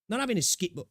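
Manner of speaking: talking through hiccups. Real, not acted. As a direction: cut off by a hiccup. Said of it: "excuse me, I got the hiccups".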